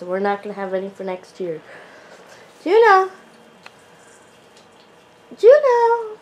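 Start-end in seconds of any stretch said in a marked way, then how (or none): 2.6-3.1 loud, high call to a pet; calling out sharply. Real, not acted. 5.4-6.2 loud, high call to a pet; calling out sharply. Real, not acted.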